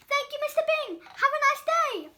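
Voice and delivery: high, loud friendly baker voice; cheery baker. Acted. Baker thanks the customer in a high, loud cheery voice. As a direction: sing it out to the customer.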